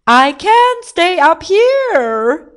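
loud, high, slow wail; distressed shout. A narrator's reading. King stuck on top pleads, shouting. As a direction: yell it with every word stretched. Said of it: "he shouted".

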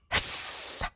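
long voiceless hissing sound; goose hiss. A narrator's reading. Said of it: "Goose went".

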